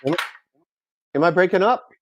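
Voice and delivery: normal talking voice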